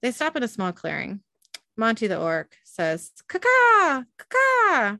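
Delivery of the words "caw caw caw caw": high, loud "caw-caw" signal; two high, ringing bird calls; mock bird call. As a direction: caw like a crow to signal friends.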